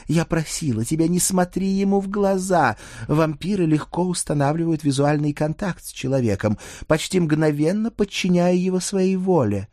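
Dana, reproachful vampire expert. Acted. Dana warns her sweetheart about vampire eye contact, reproachfully.

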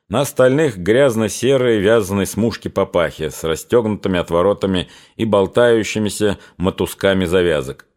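usual speaking voice

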